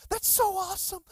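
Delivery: high, gushing praise; proud papa. Retold or imitated. Pastor praises the man who tithed, proud papa gushing. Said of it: "just like a proud papa".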